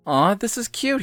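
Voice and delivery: lightly voiced high-pitched admirer; lightly played, delighted stranger; touched stranger. A narrator's reading. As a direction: coo over it. Smug stranger admiring the note.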